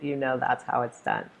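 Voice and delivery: usual speaking voice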